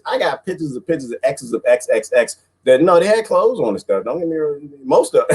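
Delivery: ordinary delivery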